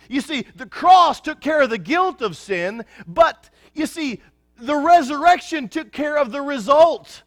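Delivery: high, loud ringing preaching voice; full-force preaching. Acted. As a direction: proclaim victory to the congregation, full power.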